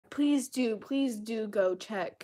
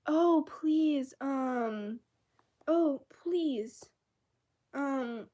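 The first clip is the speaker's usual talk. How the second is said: higher, pleading mock voice; mocking fans; 5 semitones higher, 5 dB louder, much slower than usual. Mocking the comments under the video, high and pleading. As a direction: parody the fans' begging, pitched up. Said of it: "all the comments in that vid were".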